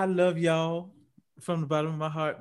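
ordinary talk

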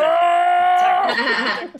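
yelled battle cry; shout. Acted. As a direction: shout it out at full volume. Yelled at the table.

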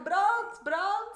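high, loud alarm call of fire; shouting fire. Shown as a demonstration. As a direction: yell it like the house is burning. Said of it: "Je moet zeggen". (luid roepen dat er brand is)

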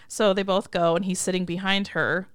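normal talking voice

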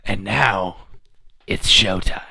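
low, part-whispered showman's reveal; hushed bad Beetlejuice, pausing for drama. Announcing the start of the ritual, bad Beetlejuice. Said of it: "I'm doing a bad Beetlejuice".

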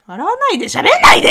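high mock-scolding shout. Real, not acted. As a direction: yell at your cohost in fun. Playfully scolding laughing cohost.

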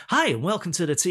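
high, loud show-host welcome; peppy mock presenter, pitched right up. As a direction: greet viewers like an over-keen TV host. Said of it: "we speak like this. We don't".

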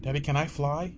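put-on a small child pleading with daddy. Child asking daddy to fly, high and eager.

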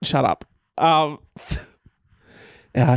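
ordinary delivery

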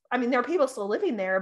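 ordinary delivery

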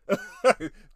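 high loud laugh; burst of laughter. Real, not acted. Laughing at own history lesson.

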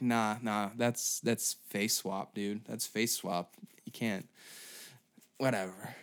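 low, soft, slow brush-off; dismissive skeptic. Retold or imitated. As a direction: wave it away like a know-it-all. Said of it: "They're like".

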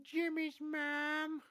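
Carl Wheezer voice. Retold or imitated. Host quoting the cartoon kid, slow high cartoon voice. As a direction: stretch it out like a dopey cartoon boy. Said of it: "real life Carl Wheezer".

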